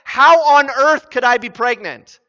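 loud, high-pitched outraged disbelief; emphatic, exasperated objection; incredulous protest. Acted. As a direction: protest hard at an impossible claim. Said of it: "She's saying".

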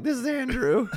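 put-on high, loud child correcting a caller; piping pre-puberty protest; little kid voice. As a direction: imitate an indignant kid on the phone. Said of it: "like the little kid voice".

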